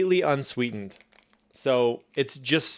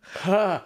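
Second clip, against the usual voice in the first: pained laugh; 7 semitones higher, 4 dB louder than usual. Real, not acted. Laughs at awful taste.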